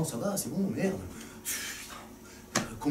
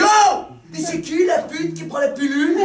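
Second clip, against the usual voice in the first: high, loud opening yell, then laughing; angry shepherd shout; 7 semitones higher, 3 dB louder, slower than usual. Acted. The shepherd yelling at his hens over the missing eggs, a high, loud shout. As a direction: bark it at the hens. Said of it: "il leur a dit".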